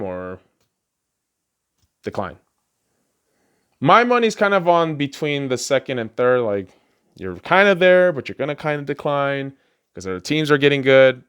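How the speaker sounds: ordinary delivery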